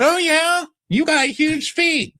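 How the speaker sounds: very high, slow, loud falsetto; hammed-up schoolyard jab; mock taunt. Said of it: "It's like".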